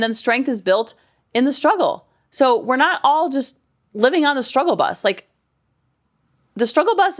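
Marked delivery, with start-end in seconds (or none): none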